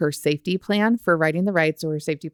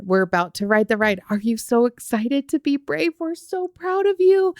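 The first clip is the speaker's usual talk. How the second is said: hyped-up parent praise; 6 semitones higher than usual. Retold or imitated. Parents hyping child before ride.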